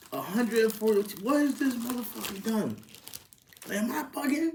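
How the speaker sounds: high, disbelieving cry about the price; incredulous outburst. Real, not acted. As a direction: voice jumps up in shock at the fee. Ranting about transfer fee.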